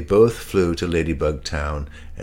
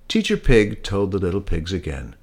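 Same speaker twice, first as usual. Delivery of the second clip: plain reading voice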